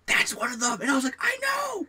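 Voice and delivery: high-pitched acted outburst, climbing to a squeal; excited squeal. Friends spot one of the hippies, excited squealing retold.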